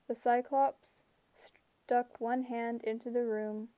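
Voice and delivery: ordinary delivery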